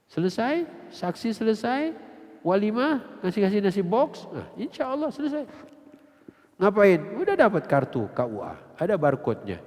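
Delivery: ordinary talk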